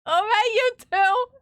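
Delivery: loud, high call-out; high, loud playful reproach; mock scolding call. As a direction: holler at two culprits caught in the act. Catching two more on the throne.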